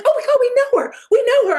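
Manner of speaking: retold high squeal of recognition; excited squeal. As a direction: shriek it like giddy fans. Friends thrilled they know her.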